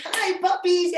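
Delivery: high, loud gushing hello to the dogs; high excited puppy greeting. Retold or imitated. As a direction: greet the puppies all bubbly and squealy. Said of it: "And so I would be like".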